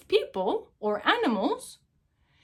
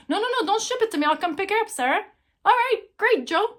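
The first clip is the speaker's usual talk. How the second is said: a bright, pitched-up Joe insisting he'll come by; high-pitched Joe; 8 semitones higher, faster than usual. Retold or imitated. Sarah reenacting Joe insisting he'll pick up the purse, pitched way up. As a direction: voice Joe a lot higher and eager.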